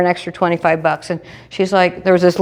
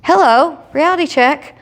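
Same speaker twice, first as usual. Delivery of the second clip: high self-talk; 7 semitones higher than usual. Retold or imitated. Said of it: "And I'm like".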